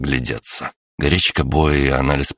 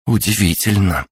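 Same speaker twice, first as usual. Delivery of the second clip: amazed; 6 semitones higher than usual. A narrator's reading. Natalya amazed at the glass.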